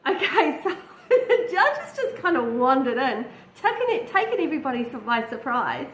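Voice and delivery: laughing through the words, high and loud; amused laughter. Real, not acted. Reviewer reacting to the judge wandering in, laughing. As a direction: let the laugh push the voice up.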